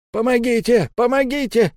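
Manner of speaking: high-pitched frantic cries for help; panicked shrieking. A narrator's reading. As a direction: shriek it out like a terrified caretaker. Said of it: "Смотритель заверещал".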